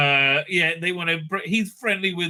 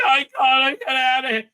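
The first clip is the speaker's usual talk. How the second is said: mock panicky whine; 9 semitones higher, 4 dB louder than usual. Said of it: "she's like".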